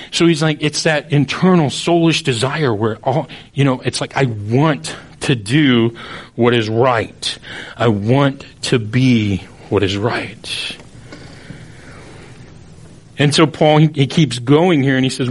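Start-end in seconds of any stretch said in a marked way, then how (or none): 4.2-10.8 very slow, word by word; deliberate longing. Acted. Preacher voicing the soul's desire, slow and deliberate. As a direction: draw out each phrase with weighty pauses.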